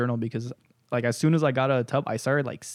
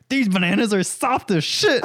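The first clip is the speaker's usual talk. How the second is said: higher, indignant outburst; mock rage; 7 semitones higher, 4 dB louder than usual. Imitating friend squeezing bananas next morning, mock rage. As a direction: exclaim in hammed-up outrage. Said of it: "pure rage".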